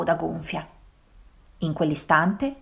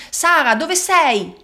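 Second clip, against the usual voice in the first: calling out; 8 semitones higher, 3 dB louder, slower than usual. A narrator's reading. Father searching in the plants.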